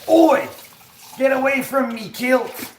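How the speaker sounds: shouted, very high comic Scottish; hammed-up Scottish shout; Scottish accent. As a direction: yell like an outraged cartoon Scotsman. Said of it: "What is something that a Scotsman would say?".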